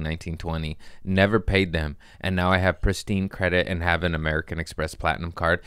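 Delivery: usual speaking voice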